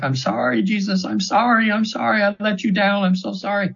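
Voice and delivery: mild mock scream. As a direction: retell her desperate apology, pitch rising. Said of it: "she's screaming like".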